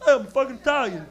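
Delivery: a touch of rowdy pride; Italian guy. Retold or imitated. Comic mimics audience member with pizza, mock Italian guy. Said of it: "One guy has a pizza slice".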